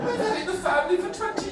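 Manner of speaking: very high, fast protest; mock farmer. Comic mocks farmer defending family land, indignant. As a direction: squeal out the defence in a hurry.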